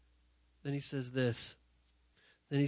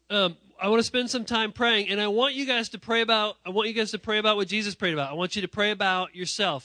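loud, high, urgent call to prayer; rally the room to pray; full-force preaching; 7 semitones higher, 11 dB louder than usual. Acted.

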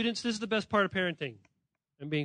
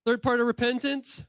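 ordinary talk

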